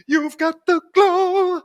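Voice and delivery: high, loud, slow shout of praise; loud stretched hype line; excited hype. Real, not acted. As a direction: hype it up, drawn out. Hyped reaction to the robot.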